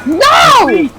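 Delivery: high, outraged shout; scandalized yell of refusal. Pretend. Pineapple-on-pizza mock outrage.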